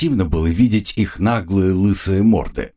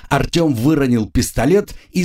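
plain reading voice

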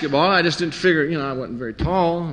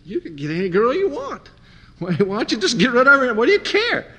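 high incredulous advice; 8 semitones higher than usual. Retold or imitated. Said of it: "and I'd go".

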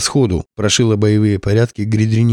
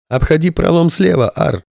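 exclaiming; 7 semitones higher than usual. Acted. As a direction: shout the tip to the pilot. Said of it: "воскликнул кто-то из спасённых".

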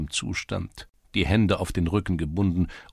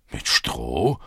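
indignant echo; 6 semitones lower, 3 dB louder than usual. A narrator's reading. Czerewik outraged at insult.

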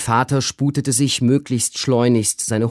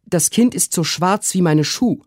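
high, hurried maid's voice; high alarmed servant; urgent maid; 8 semitones higher, 3 dB louder than usual. A narrator's reading. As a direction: press the father to hurry.

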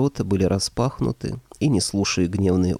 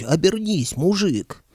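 much higher calling shout; 10 semitones higher, slower than usual. Acted. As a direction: call out to the giant. Hero keeps shouting at monster.

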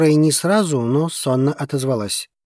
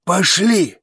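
raised-pitch, firm command; urgent, insistent order; 6 semitones higher, 4 dB louder than usual. A narrator's reading. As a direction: press her to come along. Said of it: "настойчиво повторил бывший пленник".

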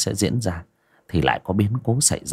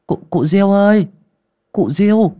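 high, loud calling into the room; 11 semitones higher, 4 dB louder than usual. A narrator's reading. Aunt calling old Diêu through the door, high and loud. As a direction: call the old woman from the doorway. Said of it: "đẩy cửa gọi".

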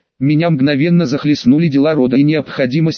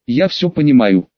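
ordinary delivery, nothing marked

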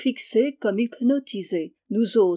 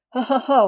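short acted laugh, higher and louder; mocking laugh; 4 semitones higher, 6 dB louder, faster than usual. Rose Air laughs off the village gossip, mocking laugh.

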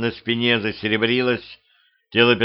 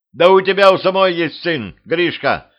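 high, loud, urgent retort; shouting; 7 semitones higher, 5 dB louder than usual. A narrator's reading. Petrovich reminding the queen of her son, shouting. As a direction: shout it over the fight. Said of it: "крикнул Петрович".

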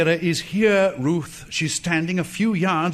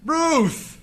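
higher, pleading call of Ruth; desperate second call; 9 semitones higher than usual. Acted. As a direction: call again, more urgent, as she is gone. Calling again, more desperate.

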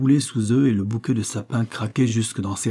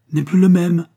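higher and louder, straining with alarm; urgent exclaim; 8 semitones higher, 7 dB louder than usual. A narrator's reading. Lieutenant urging the sergeant to listen, alarmed high call. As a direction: press the point, suddenly alarmed.

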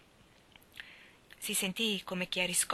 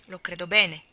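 a rough, hard-edged reply, louder; harsh voice; 9 dB louder than usual. A narrator's reading. Lori answers from under his hat, harsh and rough. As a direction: bite off the words harshly. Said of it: "con una voce aspra".

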